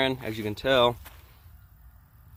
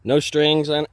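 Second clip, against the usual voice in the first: ordinary delivery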